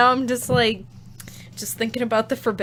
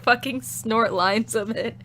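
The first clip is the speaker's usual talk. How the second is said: ordinary delivery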